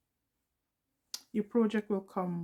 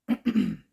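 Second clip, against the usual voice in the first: short loud throat clear; 5 semitones higher, 8 dB louder than usual. Real, not acted. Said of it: "I think I got a frog in my throat".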